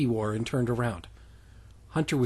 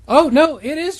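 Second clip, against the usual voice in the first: surprised; 12 dB louder than usual. Real, not acted. Sudden realisation.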